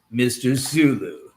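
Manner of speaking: normal talking voice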